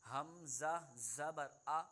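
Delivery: slow teacher's recitation of a Qaida drill; slow model recitation. Shown as a demonstration. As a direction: recite slowly for the student to copy. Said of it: "teacher models for student to repeat".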